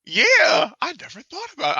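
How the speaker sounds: high squeaky laugh through words. Real, not acted. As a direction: crack up mid sentence. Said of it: "that's dark".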